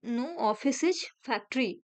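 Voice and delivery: each word drawn out slowly; slow teacher dictation of a statement. Shown as a demonstration. Rereads statement.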